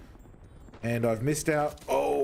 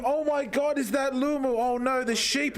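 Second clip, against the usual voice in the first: surprised; 8 semitones higher than usual. Real, not acted. Caught by a raiding friend.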